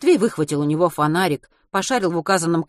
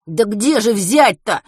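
exasperated; 4 semitones higher, 6 dB louder, slower than usual. A narrator's reading. Exasperated question.

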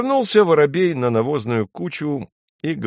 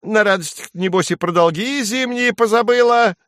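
very high sparrow voice; high-pitched, building little bird; needling sparrow; 12 semitones higher, 4 dB louder than usual. A narrator's reading. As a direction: pipe it up accusingly as a small bird. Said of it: "воробей говорит".

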